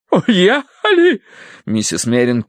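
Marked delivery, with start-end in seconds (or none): 0.1-1.2 high, drawn-out shocked echo; aghast. A narrator's reading. Wife hears the guests have left, aghast. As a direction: repeat it in stunned horror.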